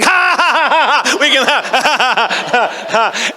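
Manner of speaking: loud, high, exaggerated ha-ha-ha; imitated group laughter. Producers' entourage erupts at the joke, mock laughter. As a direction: mimic a whole entourage cracking up. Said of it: "everyone else was like".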